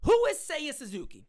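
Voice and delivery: ordinary talk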